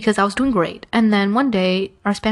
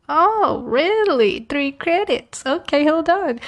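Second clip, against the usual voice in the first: higher, hammed-up "oh really?" with the pitch jumping up; mock surprise; 8 semitones higher, much slower than usual. Student retelling the counselor's pitch, mock-impressed and much higher. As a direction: play it like you've just been sold something too good to be true. Mock reaction to three credits.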